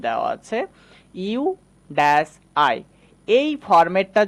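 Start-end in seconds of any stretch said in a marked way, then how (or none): none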